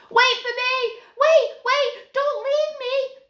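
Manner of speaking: very high, loud panicked wail, slowing at the end. A narrator's reading. Youngest Snaggart begs the fleeing gang to wait, panicked wail. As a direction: beg them not to leave you, wailing. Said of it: "wailed the youngest".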